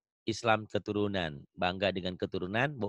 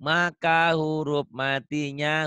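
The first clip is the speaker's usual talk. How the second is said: slow stress; 4 semitones higher, 4 dB louder, much slower than usual. Shown as a demonstration. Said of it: "Setiap huruf mati".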